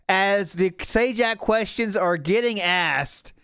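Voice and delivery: slow, drawn-out hype commentary; mock event announcer. Host announcing a new question entering the fray, mock event announcer. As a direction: announce it grandly, stretching the words.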